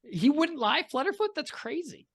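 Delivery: high-pitched, exaggerated incredulity; playfully scandalised, voice leaping high; mock disbelief. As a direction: feign shock, pitch shooting way up. Mock disbelief at player's trust.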